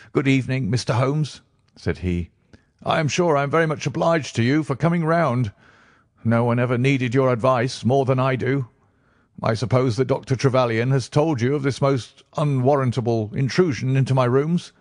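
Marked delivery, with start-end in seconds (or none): 0.1-1.4 higher, reedy voice, jangled nerves; nervous patient. Acted. Jittery patient greeting Holmes, reedy and nervous. 2.8-14.7 higher, reedy voice, jangled nerves; nervous patient. Acted. Jittery patient greeting Holmes, reedy and nervous.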